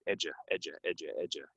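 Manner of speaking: edu- said EJ-uh, the D like a j. Shown as a demonstration. Teacher drilling edu- with a j sound. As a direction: soften the D in edu- to j. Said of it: "This D is gonna sound like a j".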